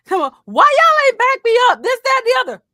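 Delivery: high, loud mock yell; angry yelling. Angry girl yelling at friends for not backing her, high mock yell. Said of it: "Angry girl is yelling".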